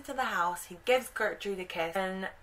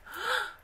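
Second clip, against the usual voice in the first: high, sudden intake of breath; storyteller's frightened gasp; dramatic gasp. Acted. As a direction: gasp as if startled. Storyteller's gasp in dark forest.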